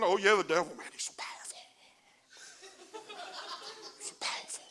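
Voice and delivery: breathy mocking whisper of a bragging churchgoer. Preacher mocking people who brag on the devil, in a whisper. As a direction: whisper it like someone gossiping about the devil. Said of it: "When I hear people start bragging on".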